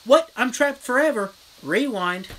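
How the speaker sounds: mock surprise. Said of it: "He's like".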